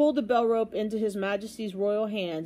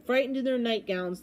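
ordinary delivery

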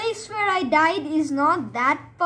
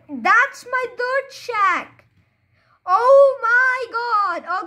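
high, slow, rising joy at finding the shack; drawn out in delight, almost shouting; excited discovery; 9 semitones higher, 5 dB louder, much slower than usual. Real, not acted. Gamer spotting his lost dirt shack, thrilled and loud.

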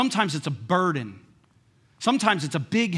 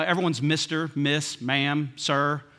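ordinary talk, nothing marked

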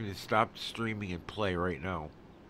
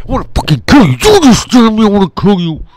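full-force furious scream, pitched high; explosive screamed threat; enraged screaming; 9 semitones higher, 24 dB louder, much faster than usual. Real, not acted. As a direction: scream it at the top of your lungs. Ranting about irritating lights.

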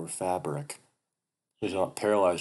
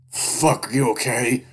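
alarmed shout; 6 semitones higher, 9 dB louder, slower than usual. Acted. Carl after narrator's hard fall.

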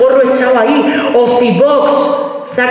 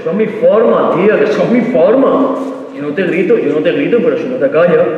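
put-on boyfriend's voice; 3 semitones lower than usual. Comic imitating boyfriend excusing his manners, lower and defensive.

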